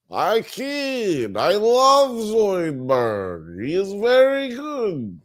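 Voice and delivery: high, slow alien doctor voice; Zoidberg impression. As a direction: stretch it out like Zoidberg. Second try at Zoidberg voice.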